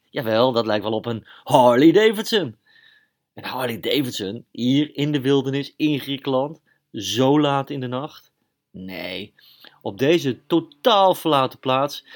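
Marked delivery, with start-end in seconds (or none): 0.0-2.6 louder, rising to an excited Harley Davidson; sudden loud excitement at the engine sound; excited realisation. Acted. 8.7-9.3 breathy whispered nee; soft unvoiced denial. Doubting himself in the night. 9.8-12.0 incredulous. Acted. Disbelief at the deserted place.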